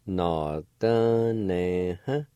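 Nọ tơ nè hả drawn out slowly, each syllable clear; slow demo. Shown as a demonstration. Teacher models the word slowly for learners. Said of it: "It's pronounced like this".

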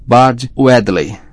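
"Bard Wedley" drawn out, very slow and thick; bloodied-mouth speech. A narrator's reading. Ron gives false name through bleeding mouth, "Bard Wedley" garbled slowly. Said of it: "sua boca estava ensanguentada".

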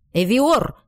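hoarse sharp call of a name. A narrator's reading. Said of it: "голос короля стал хриплым".

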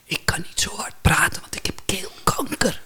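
whispered, voiceless strained croak; barely voiced rasping whisper; throat-cancer voice. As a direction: imitate talking through a damaged throat. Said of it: "zijn vingers op zijn keel".

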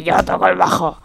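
just a hint of the old rocker, lightly voiced. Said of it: "con voz de [name]".

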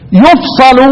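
يفصل said "yufsalu"; u on the ya, single s with a in يفصل; يفصل as "yuf-sa-lu"; u-then-a reading. Shown as a demonstration. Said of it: "طب هنيجي التانيين".

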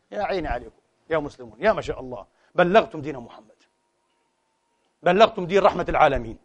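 usual speaking voice

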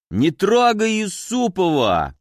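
put-on drunk bark. A narrator's reading. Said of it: "пьяно рявкнули с той стороны".

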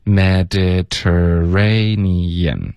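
very slow Mediterranean, every syllable held; teaching model. Shown as a demonstration. Teacher modelling the word for repetition, very slow and evenly drawn out. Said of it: "请老师教我们读一下".

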